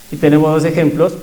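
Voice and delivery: normal talking voice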